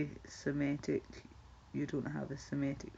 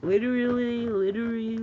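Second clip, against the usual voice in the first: put-on higher, louder girly "Literally"; mock valley girl; 6 semitones higher, 8 dB louder than usual. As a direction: ham up the gushing "Literally". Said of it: "if I try and say it too fast".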